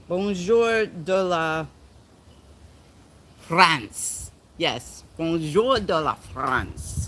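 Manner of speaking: Bonjour de la France, drawn out and slow; slow French phrase. Shown as a demonstration. Greeting a French viewer: slow, savored French phrase. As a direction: savor the French words slowly. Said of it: "slow French phrase".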